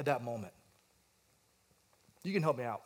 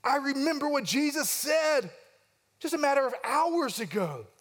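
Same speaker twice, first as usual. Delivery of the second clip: anguished realization; 8 semitones higher than usual. Retold or imitated. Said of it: "And he thinks".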